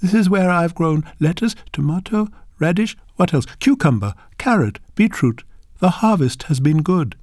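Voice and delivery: a humble farmer showing off her harvest; proud grandmother. Acted. Grandmother shows her garden.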